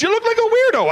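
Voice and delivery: high mocking girlfriend; girlfriend's voice. Girlfriend mocking boyfriend's walk, high and scornful. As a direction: jeer at him in a shrill-free high mock voice.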